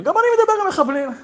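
quick, very high-pitched retort; insistent retort. Retold or imitated. Officer claiming likeness to the rabbi, insistent retort. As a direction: fire it back, voice shooting up.

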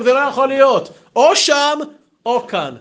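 high, loud objection; acted exasperated protest. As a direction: demand one or the other, loudly. Retold exasperated argument.

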